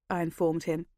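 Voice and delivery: ordinary delivery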